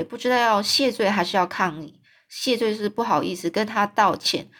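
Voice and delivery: ordinary delivery, nothing marked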